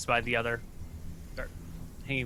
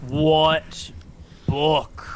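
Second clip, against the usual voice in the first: giant voice; 3 semitones higher, 6 dB louder than usual. Acted.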